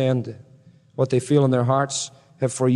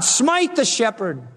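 higher, slow solemn call; solemn cry; 7 semitones higher, slower than usual. Acted. God crying to the sword at Calvary, slow and lifted. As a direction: cry it out like a command from heaven.

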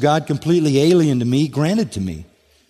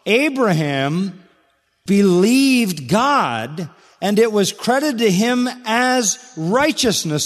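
very slow raised reading; 8 semitones higher, slower than usual. Acted. Reading Scripture slowly and high.

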